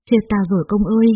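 plain reading voice

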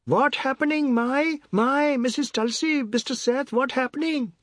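high, urgent, frightened calling; high-pitched worried hollering; neighbours shouting. A narrator's reading. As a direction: call out in alarm from next door. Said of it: "Neighbours were shouting".

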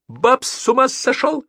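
very high, alarmed cry; panicked yell. A narrator's reading. Passenger reporting that Babs went mad, high panicked yell. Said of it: "заорал он".

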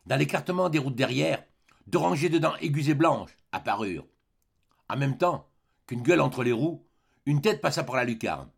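ordinary delivery, nothing marked

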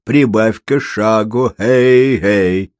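slow, drawn-out calling to a horse; long-drawn "hey" of a driver on the road; carter's cries. A narrator's reading. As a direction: urge on an old horse with long calls. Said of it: "послышалось хлопанье кнута и крики".

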